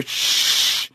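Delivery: long, insistent hissing shh; whispery, drawn-out shush; hissed shush. A narrator's reading. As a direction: hush them with a hiss. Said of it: "he hissed".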